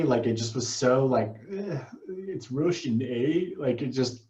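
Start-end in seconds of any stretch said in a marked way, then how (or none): 1.5-3.5 soft, slow Russian-accented mimicry; bad Russian accent. Mocking Yuri's bad delivery, bad Russian accent. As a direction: mumble a poor Russian accent, drawn out. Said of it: "That was bad Russian accent".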